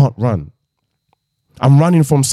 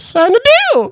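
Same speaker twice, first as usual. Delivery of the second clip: very high eerie demon voice; high, strange voice of a demon giving an order; 3 dB louder than usual. Pretend. Demon voice in pretend scene.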